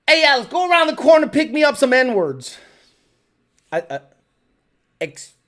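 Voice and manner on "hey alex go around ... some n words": louder, higher, hurried boss calling out; boss's order. Retold or imitated. Boss sends Alex round the corner, calling out. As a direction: call out an order like a pushy boss. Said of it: "I'll say what he said".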